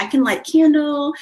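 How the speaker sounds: light up as you say candle; excited. Real, not acted. Telling listeners her new money ritual, excited. Repeats idea with delight.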